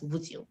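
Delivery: would you run together as "wouldju"; linked J sound. Shown as a demonstration. Student giving example of rule, would you as "wouldju". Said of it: "then it becomes a J sound".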